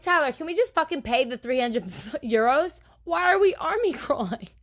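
whiny, laughing plea to Tyler; laughing complaint. Retold or imitated. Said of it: "I'm like".

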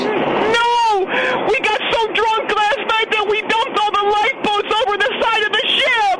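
desperate wail; panicked cry. Acted. Panicked confession in flood.

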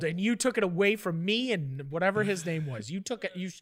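ordinary talk

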